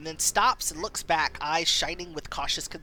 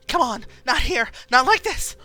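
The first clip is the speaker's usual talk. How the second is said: rising louder and higher desperation; desperate strain; 7 semitones higher, 8 dB louder than usual. Acted. Injured mother straining for the boat, desperate cries. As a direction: push harder each phrase.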